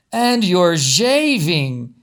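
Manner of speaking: drawn-out, loud delighted greeting like a fussy family friend; gushing Pearline. A narrator's reading. Pearline feels his stubble.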